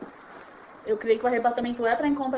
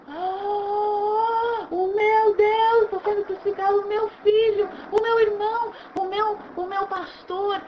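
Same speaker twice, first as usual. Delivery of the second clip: higher, louder, drawn-out grieving cry; slow high mourning cry; anguished lament; 7 semitones higher, 3 dB louder, slower than usual. Acted. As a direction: wail as if watching him die. Acts anguish at the cross.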